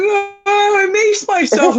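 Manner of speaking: high, slow, wailing complaint; whiny wail. Retold or imitated. Kid bursting in to his mom, wailing. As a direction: whimper it out like a hurt kid. Bursting in after macing himself.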